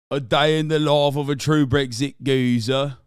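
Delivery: loud hammed-up British geezer accent; mock British geezer. Streamer announcing a Brexit geezer vlog in a loud mock British accent.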